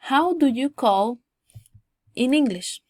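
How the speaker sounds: loud, slow teaching model; model for repetition. Shown as a demonstration. Teacher recaps phrases for students to repeat, slow model. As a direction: say it slowly for learners to repeat. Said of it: "lo repitan de nuevo".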